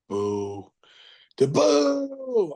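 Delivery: boo low then boo high. Shown as a demonstration. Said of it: "That went from".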